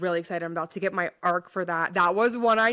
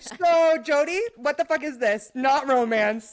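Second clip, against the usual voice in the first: higher, half-laughing mock outrage; mock indignant quote; 5 semitones higher, 5 dB louder than usual. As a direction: throw it at Jody in mock outrage. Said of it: "I literally was like".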